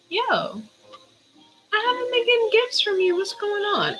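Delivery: squeaky high mock plea; high voice to Gaia. Asking Gaia for gifts in a high squeaky voice. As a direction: pitch it way up, teasing Gaia. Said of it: "and I was like".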